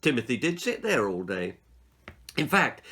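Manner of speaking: ordinary delivery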